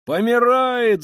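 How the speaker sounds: high, loud drawn-out cry; shout. A narrator's reading. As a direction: shout the alarm across the street. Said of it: "закричал кто-то". (громкий высокий крик)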